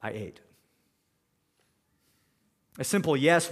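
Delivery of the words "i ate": low, soft reluctant mumble; a quiet mumbled confession; mumbled under breath. Retold or imitated. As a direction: grudgingly mutter the admission. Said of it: "as if almost mumbling under his breath".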